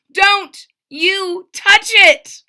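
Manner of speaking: slow, loud, high warning shout. A narrator's reading. Bartholomew warning the trumpeter off, slow loud shout. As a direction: stretch out each word as a shouted warning. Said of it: "shouted Bartholomew".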